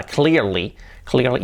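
"clearly" as KLEER-lee; English R in "clearly"; correct pronunciation. Shown as a demonstration. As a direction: model "clearly" with long EE. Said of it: "Esa palabra hay que trabajarla".

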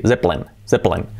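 "Zeppelin" as low ZEP-lin; two syllables, voiced Z in "Zeppelin"; correct English pronunciation. Shown as a demonstration. Teacher repeating how it is said, English pronunciation. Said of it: "es así".

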